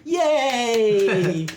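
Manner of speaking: high, drawn-out mock cheer; cheering acolyte. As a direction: cheer like a devoted follower. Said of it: "Your acolyte begins clapping".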